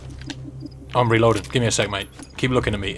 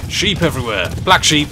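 surprised; 5 semitones higher, 5 dB louder than usual. Real, not acted. Gamer spotting a dark sheep in the field, surprised exclaim.

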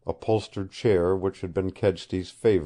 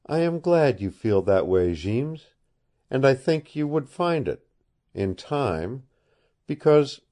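lightly voiced young woman, pitch raised; a hint of an earnest girl pleading; 6 semitones higher than usual. Woman answers Kent gladly.

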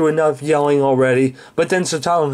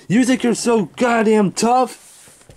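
higher, slow, drawn-out angry challenge; escalated challenge; 5 semitones higher, slower than usual. Acted. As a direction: square up to him, stretching out each word. Staged quarrel, challenge repeated and escalated.

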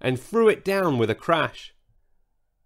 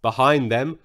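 ordinary delivery

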